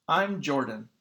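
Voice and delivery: ordinary delivery, nothing marked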